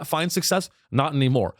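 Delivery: ordinary talk, nothing marked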